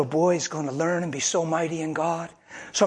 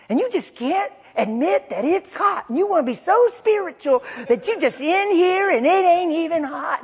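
much higher, shrill-pitched scolding voice; coworker yelling; 4 dB louder than usual. Retold or imitated. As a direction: lay into him like an annoyed coworker. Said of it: "They yelled at me".

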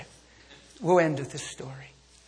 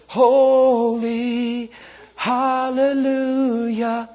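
loud, drawn-out mock hallelujah; mock churchy praise; 7 semitones higher, 12 dB louder, much slower than usual. Preacher mocks Sunday hypocrites, hammed-up hallelujah. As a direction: parody a showy Sunday worshipper.

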